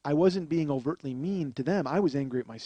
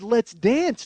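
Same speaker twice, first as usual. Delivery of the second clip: calling out; 8 semitones higher, 9 dB louder than usual. Retold or imitated. Calling out on the game show.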